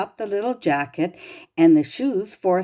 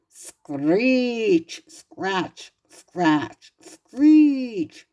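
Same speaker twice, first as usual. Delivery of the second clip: hoe noises; 2 semitones higher, much slower than usual. A narrator's reading. Reading to a child, mimicking McGregor's hoe scraping the ground. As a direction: scrape out the sounds like a garden hoe. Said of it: "he heard the noise of a hoe".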